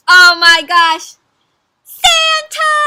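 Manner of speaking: very high, loud, drawn-out squeal; slow build into a huge shriek; excited scream. A narrator's reading. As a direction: scream it like thrilled fans. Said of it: "scream all the new friends".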